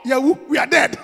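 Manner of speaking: mock panic. Close friends panicking they are finished, loud shout. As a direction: cry out as if doomed. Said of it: "Those who are close to say".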